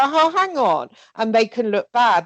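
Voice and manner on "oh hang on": high, startled oh hang on; playful mock surprise; mock double take. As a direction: pretend to catch yourself. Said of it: "when you go".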